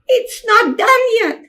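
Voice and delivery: put-on a defiant small child; little girl's voice. Little sister protesting she won't be a person, high child voice. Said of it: "children's voice".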